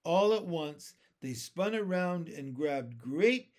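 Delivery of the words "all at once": usual speaking voice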